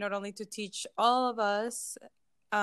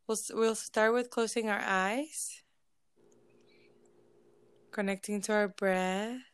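unhurried, measured relaxation voice with lingering silences; guided meditation. Real, not acted. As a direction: ease listeners into stillness, phrase by phrase. Leads a group meditation.

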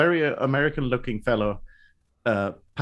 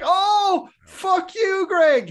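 much higher, louder joyful mock insult; excited greeting shout; 4 dB louder, much slower than usual. [name] greeting Greg with the meme, high, loud and joyful. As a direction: throw your arms open and yell it. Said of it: "I'm like".

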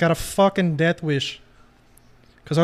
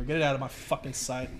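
normal talking voice

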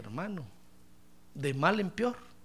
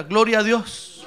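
call-and-response prompt; 8 dB louder, slower than usual. Shown as a demonstration. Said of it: "A ver, diga".

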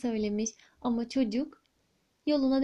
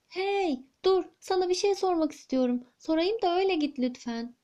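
put-on higher, eager child calling after the mouse; a little boy calling out, a touch raised; 6 semitones higher than usual. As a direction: call out brightly as a small boy.

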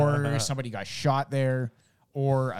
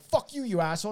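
high-pitched angry swearing; retold mad neighbour; 9 semitones higher than usual. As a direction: imitate an angry man cursing back. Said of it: "he was fucking mad".

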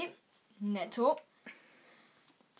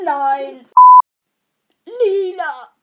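loud answer; 17 dB louder, much slower than usual. Acted. Shouted quiz answer.

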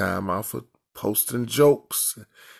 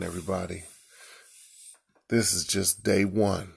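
ordinary delivery

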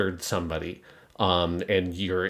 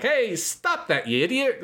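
much higher, slow, offhand scolding; casual scold; 13 semitones higher than usual. Retold or imitated. Suletta brushing off the attacker, high and slow. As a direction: scold him like a pesky kid. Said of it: "says it like".

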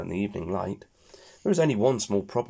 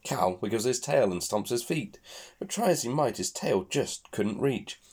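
usual speaking voice

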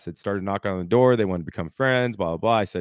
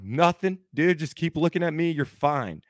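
retold high-pitched attempt to reassure; panicked reassurance; 7 semitones higher than usual. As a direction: cover alarm with a high, hurried comfort. Retold reassuring of son being stapled.

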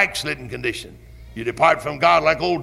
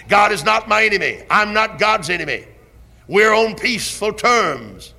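full-force revival preaching, rising and booming on key words; fiery preaching; 3 semitones higher, 5 dB louder than usual. Acted. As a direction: thunder it from the pulpit. Preaching on the blood atonement.

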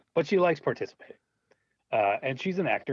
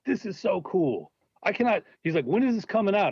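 excited fan; 8 semitones higher than usual. Retold or imitated. Repairman raving about the cave.

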